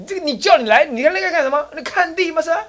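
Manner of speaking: put-on high, loud angry old man; old man yelling at the visitor from a distance; scolding from afar. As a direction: shout him off the land. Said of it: "远远看到那家伙就骂".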